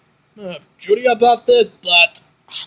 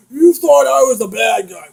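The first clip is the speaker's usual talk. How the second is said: normal talking voice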